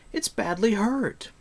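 high-pitched protest; pleading cry. A narrator's reading. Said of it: "cried Taran".

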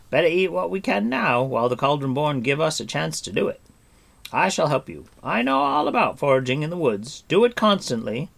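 eager bard agreeing heartily; booming jolly bard; hearty bard. A narrator's reading. Bard voiced.

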